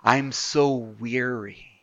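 slow, breathless whisper of exhaustion; weary whisper. Retold or imitated. Preacher voicing a worn-out believer, slow tired whisper. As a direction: sigh the words out slowly, worn out. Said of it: "How many times do we say in a day".

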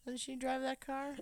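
lightly voiced classmate, pitched high; nosy classmate. Classmate asking a boy about his yelling mom, mock-curious. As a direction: pipe up high like a puzzled schoolmate.

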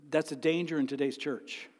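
usual speaking voice

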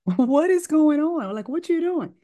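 high, incredulous what is going on; rising disbelief at a patient packing up; surprised. Retold or imitated. Doctor catching the patient halfway out the door, startled and high.

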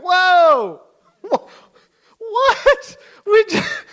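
very high, loud squawk of disbelief; shocked outburst. Retold or imitated. Leader hearing Jonathan's threat, high shouted alarm. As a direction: yelp it in comic, hammed-up shock.

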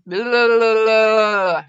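loud blubbering "blah" noise; tongue-tied babble. Real, not acted. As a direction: shake out a tripped-up tongue. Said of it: "my tongue's too excited today".

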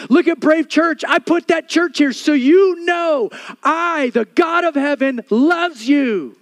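loud, high, insistent preacher's cry; full-force preaching. Acted. Pastor to congregation, preaching loud and high. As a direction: pound home God's love to the crowd.

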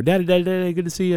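high, eager little-kid voice, lightly voiced; a light imitation of excited young kids at the door; kids greeting dad.

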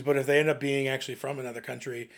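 ordinary delivery

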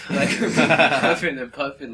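loud laughing, slowed down. Real, not acted. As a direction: laugh while telling it. Laughing about panting guy.

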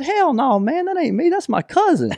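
high-pitched mock protest; retold frantic denial. Retold panicked denial when confronted.

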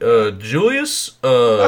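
slow, halting presidential impression; Barack Obama. Comic mimics Obama addressing Julius, slow halting impression. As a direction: drag out each "uh" and pause after it. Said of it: "you know how Obama talks".

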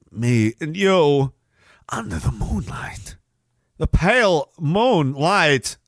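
slow, drawn-out sinister poetry; theatrical menace. Acted. Host daring opponents to dance, theatrical menace. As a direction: stretch each phrase out dramatically.

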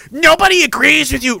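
mock critic. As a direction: imitate a dismissive critic.

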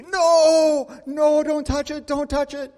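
high, loud panicky refusal; frightened child. Retold or imitated. A hurt daughter begs her father not to touch her finger, high panicked pleading. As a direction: beg them not to touch it.

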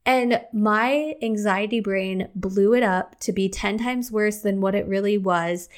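usual speaking voice

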